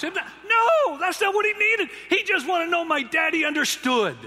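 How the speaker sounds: high, loud preacher's cry; emphatic shout. Real, not acted. Preacher shouting to the men, high and loud. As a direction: shout it out to the crowd.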